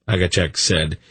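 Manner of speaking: plain reading voice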